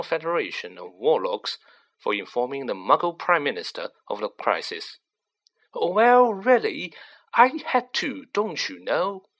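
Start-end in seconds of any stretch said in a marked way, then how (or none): 5.7-9.3 irritable official, pitched up and emphatic; higher-pitched, exasperated self-defence. A narrator's reading. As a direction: protest in a higher, testy voice. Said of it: "said the irritable Fudge".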